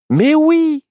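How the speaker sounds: very high, loud burst of realization; piercing eureka from the owl-girl; excited cry. A narrator's reading. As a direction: cry out as the idea strikes. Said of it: "s'écria Myrtille".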